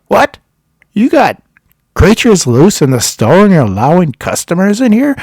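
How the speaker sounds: high, loud indignant outburst; acting out an outraged telling-off; scolding. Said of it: "he starts scolding me".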